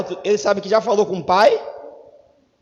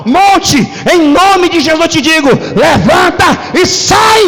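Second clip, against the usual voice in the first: shouted command, louder, slowing on levanta e sai; 5 semitones higher, 8 dB louder, slower than usual. Shown as a demonstration. Preacher showing how to speak to the mountain, shouting. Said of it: "olhar pro monte e dizer assim".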